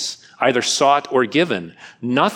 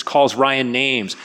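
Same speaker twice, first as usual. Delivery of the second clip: ordinary delivery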